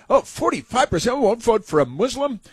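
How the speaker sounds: mocking a news anchor's shock at a poll; mock astonishment. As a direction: ham up her outrage at the number. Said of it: "Why isn't she astonished and shocked".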